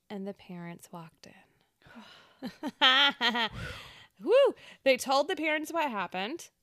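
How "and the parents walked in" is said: slow breathy whisper. A narrator's reading. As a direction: whisper it for suspense. Scary climax of ghost story.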